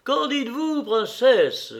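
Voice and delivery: appel ravi, bien plus aigu, lancé par-dessus la foule; s'écrier, ravi, au milieu de la foule; voix ravie. A narrator's reading. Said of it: "la voix ravie de Stepan Arkadievitch". (Oblonsky interpelle la princesse dans la foule, ravi et aigu)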